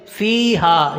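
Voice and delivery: फ़ीहा with long ee and long aa; both syllables lengthened. Shown as a demonstration. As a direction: hold both vowels of फ़ीहा. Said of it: "फ़ा और हा दोनों लंबे होंगे". (फ़ीहा में दोनों हर्फ़ लंबे)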